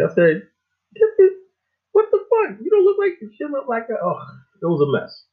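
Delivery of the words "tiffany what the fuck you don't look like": high, loud, shocked cry at the name; imitating his own stunned outburst; shocked disbelief. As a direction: recoil in disbelief, voice jumping high. Retold shock on seeing girl unmade.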